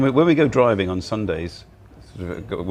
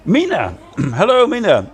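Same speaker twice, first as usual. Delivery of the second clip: excited greeting; 10 semitones higher, 6 dB louder than usual. Real, not acted. Greeting a viewer by name.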